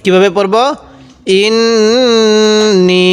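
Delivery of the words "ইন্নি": ইন্নি with the long ই held very slowly; stretched recitation. Shown as a demonstration. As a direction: drag out ইন্নি in a Quran reciting tone. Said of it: "এক আলিফ টান দিতে হবে".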